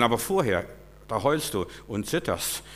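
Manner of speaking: ordinary talk, nothing marked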